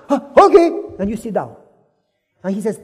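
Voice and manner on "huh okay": high, loud startled okay; taken aback; startled giving in. Acted. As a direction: caught off guard, obeying at once. Debtor startled into obeying.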